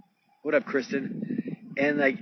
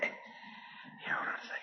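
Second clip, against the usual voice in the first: whispered aside, much softer; 18 dB quieter than usual. Real, not acted. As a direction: drop to a whisper for a quick aside. Aside while on the phone.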